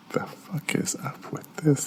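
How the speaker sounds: slow, whispered disbelief; baffled whisper. Retold or imitated. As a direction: whisper it as a stunned private aside. Baffled aside about mother.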